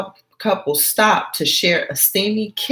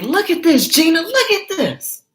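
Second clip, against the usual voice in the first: excited friend; 12 semitones higher than usual. Acted. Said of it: "she's like".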